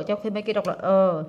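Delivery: normal talking voice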